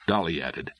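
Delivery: ordinary delivery, nothing marked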